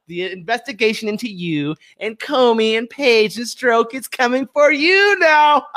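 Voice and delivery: gloating warning at the enemy; slow gloating threat. Real, not acted. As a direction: savour every word as a warning. Taunting CNN and Democrats.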